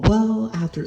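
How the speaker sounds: high-pitched mocking officialese; mock formal. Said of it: "that stupid formal language".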